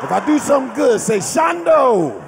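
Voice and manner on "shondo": drawn-out, high cry of approval; celebratory call-out. Shown as a demonstration. Host showing the crowd how to cheer him, celebratory call-out. Models crowd response for doing good.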